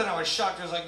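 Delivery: normal talking voice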